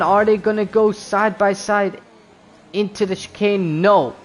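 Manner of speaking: excited live race call; motorsport commentator. Acted.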